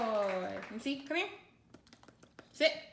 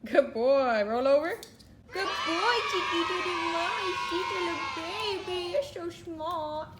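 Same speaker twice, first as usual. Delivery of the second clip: louder, higher gushing over the dog; excited loud praise; 4 semitones higher, 7 dB louder than usual. Real, not acted. Owner praising her dog after the trick, excited loud praise. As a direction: cheer him on like he's done something huge.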